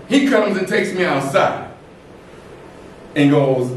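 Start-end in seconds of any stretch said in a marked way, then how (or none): none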